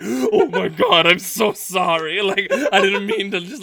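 mock-sorry voice broken by laughter; laughing apology. Host dad apologizing to fasting boy, laughing.

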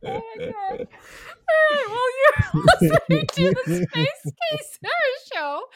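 high, loud, laughing hard; cracking up mid-intro. Real, not acted. Host cracking up after the bit.

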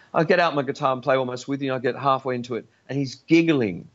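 ordinary talk

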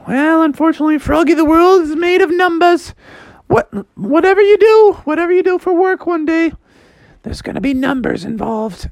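high-pitched mom voice; high, emphatic mock mom; Froggy's explaining mom. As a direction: break the news in a high motherly voice.

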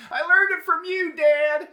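put-on high, loud, desperate kid shout; shouted commercial line. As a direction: yell it like the kid in the ad. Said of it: "like, uh, the drugs commercial".

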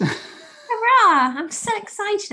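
loud, high sarcastic hurrah; mock cheer. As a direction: fake enthusiasm, overdone. Sarcastic cheer at more seasons.

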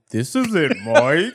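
slow, put-on prank-call voice with a laugh; mock denial, drawn out and amused; imitated prank caller. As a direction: stretch out a badly disguised phone voice. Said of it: "I was like, Mike, what do you want".

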